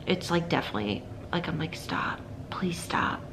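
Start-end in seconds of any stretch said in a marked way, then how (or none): 1.8-3.2 slow, whispered, drained "stop, please stop"; mother whispering her exhausted plea; weary whispered plea. Retold or imitated. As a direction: beg quietly, at your limit. Pleading inwardly with whining baby.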